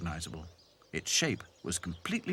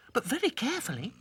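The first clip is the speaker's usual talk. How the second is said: quick, high little voice; high doll voice; faster than usual. Acted. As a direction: chirp it quickly. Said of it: "added Lucy".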